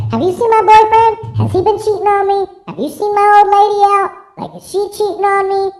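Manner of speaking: high, loud whiny caller voice; mock worried caller. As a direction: play a frantic jealous caller. Mock caller asking about cheating.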